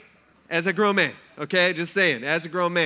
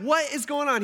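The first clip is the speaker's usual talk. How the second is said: higher, exasperated rebuke; Paul angry; 8 semitones higher than usual. Retold or imitated. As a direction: throw the question at them in disbelief. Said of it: "Paul is mad".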